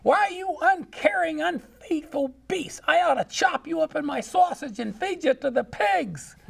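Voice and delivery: hammed-up granny tantrum; screaming granny. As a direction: shriek it at the husband like a furious old woman. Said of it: "she's screaming at this guy".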